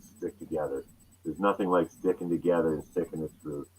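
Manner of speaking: soft advice. Retold or imitated. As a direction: pass on wisdom gently and low. Idol's advice, quoted softly.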